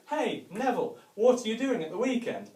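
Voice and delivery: friendly neighbour. Retold or imitated. Neighbour voiced asking.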